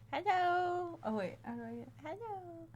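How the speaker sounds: high, soft cooing to a cat. Real, not acted. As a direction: coo gently at a pet. Greeting the cat softly.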